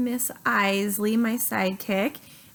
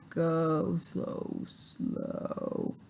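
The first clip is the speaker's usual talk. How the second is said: dropping much lower and softer, fading to a whisper; slowing down; 5 semitones lower, 9 dB quieter, much slower than usual. Shown as a demonstration. Mom showing her daughter how sugar slows the fighter cells, fading low. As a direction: let the words sink and die away.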